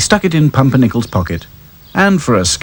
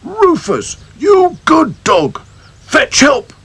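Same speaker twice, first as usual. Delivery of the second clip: joyful call; slower than usual. Acted. Farmer calling up to the dog, overjoyed. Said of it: "he called".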